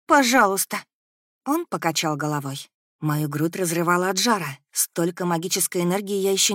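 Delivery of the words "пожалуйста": high, loud begging; near tears. A narrator's reading. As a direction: plead as if about to cry. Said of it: "почти заплакала я". (умоляюще, почти со слезами)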